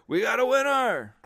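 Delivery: a lightly hammed-up game-show announcement; Drew Carey host call. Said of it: "Drew Carey in the background saying".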